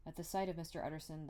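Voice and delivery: plain reading voice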